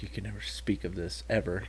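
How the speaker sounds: low, hushed mock warning; mock secretive warning. Mock contract demand.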